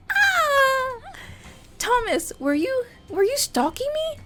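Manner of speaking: very high, thin frightened voice; unnaturally high pitched. Acted. Chelsea confronting Thomas, voice way up high. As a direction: squeeze the voice up high in fear. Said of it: "Chelsea's voice is unnaturally high pitched".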